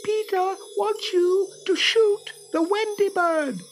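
shrill, very high fairy voice; Tinker Bell. Acted. Fairy orders the boys to shoot Wendy, shrill. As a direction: squeal it slowly in a tiny piercing voice. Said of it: "the shrill voice of Tinker Bell".

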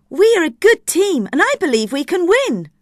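high, loud, confident cheer; rallying the team. Acted. Production assistant rallying colleagues to win, excited. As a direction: pitch it up and urge them on.

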